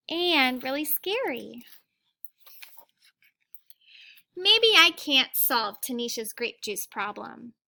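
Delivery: put-on reading a picture book aloud to children; gentle read-aloud storytelling; teacher reading to the class; story reading. A narrator's reading.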